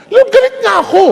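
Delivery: put-on high, loud childish protest; husband protesting to God; petulant whine.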